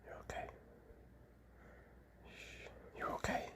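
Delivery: barely audible tender whisper; calm him with a breath; gentle whispered reassurance to a snake; soothing whisper. Real, not acted. Calming the snake.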